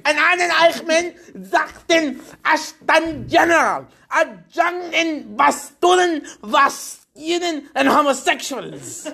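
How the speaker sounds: high, slow mock German shouting; fake German shouting. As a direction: shout gibberish German like a cartoon Nazi. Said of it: "shouting in German or fake German".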